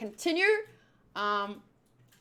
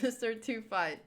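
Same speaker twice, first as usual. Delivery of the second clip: ordinary talk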